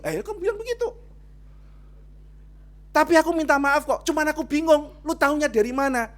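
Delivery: high, loud insistent retort; retelling the church argument, pressing high and loud; animated retold argument. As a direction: push back on the accuser playfully.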